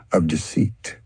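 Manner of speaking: ordinary delivery, nothing marked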